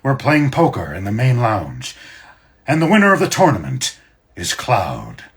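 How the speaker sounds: loud, high-pitched ship's captain announcing; Captain Titov. Shown as a demonstration. Captain announcing the poker tournament winner, loud and captainy. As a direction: project it like a captain to a crowded lounge. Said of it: "he's kinda like this".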